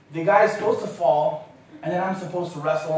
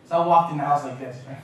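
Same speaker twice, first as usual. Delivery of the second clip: usual speaking voice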